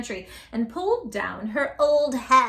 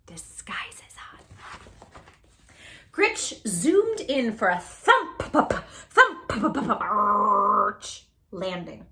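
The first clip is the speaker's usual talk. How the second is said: ordinary delivery, nothing marked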